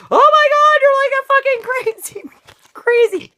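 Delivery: high, loud, aghast outburst; hammed-up horrified shriek; shocked yell. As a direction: recoil in disbelief at her. Man shocked by her rage.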